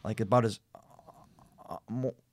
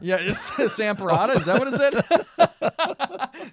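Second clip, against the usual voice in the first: high laughing disbelief; cracking up at the misreading; 12 semitones higher, 7 dB louder, slower than usual. Real, not acted. Co-host misreads a name, laughing hard.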